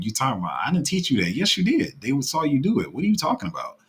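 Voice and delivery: high-pitched, exasperated telling-off; mock-indignant callout. Calling out parents in imagined argument.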